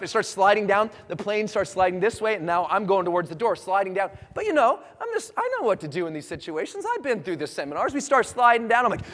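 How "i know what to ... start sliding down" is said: ordinary delivery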